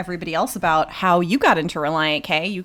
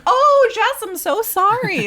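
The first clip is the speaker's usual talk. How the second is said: high, louder mock gushing apology; apologetic aunt; 13 semitones higher, 4 dB louder, slower than usual. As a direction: imitate a flustered aunt apologizing on the phone. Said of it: "And she'd be like".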